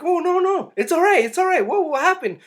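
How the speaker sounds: high-pitched, urgent calming voice; acted alarmed reassurance. Man to the scared kidnapped woman, acted anxious reassurance. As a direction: imitate someone anxiously soothing a frightened person, high.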